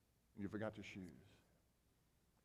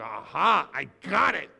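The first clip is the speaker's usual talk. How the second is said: loud knowing aha; eager; 12 dB louder, slower than usual. Retold or imitated. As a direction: pounce on the idea. Peter eager this time.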